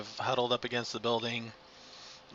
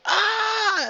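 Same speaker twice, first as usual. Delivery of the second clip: sudden high, loud "Ah!"; loud cry; 10 dB louder than usual. Acted. Game master voices the startled cry everyone hears, loud yell. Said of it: "everybody hears this loud".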